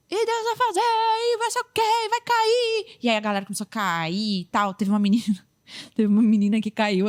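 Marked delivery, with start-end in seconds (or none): 0.1-3.0 high, slow, hammed-up preacher chant; mock preacher announcing the miracle, high and slow. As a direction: parody her drawn-out promise that people will fall. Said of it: "aquela coisa".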